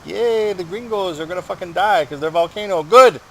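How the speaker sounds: high, loud mock cheering; gleeful South Americans. Mocking foreign papers cheering America's fall, high gloating voice. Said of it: "They're like".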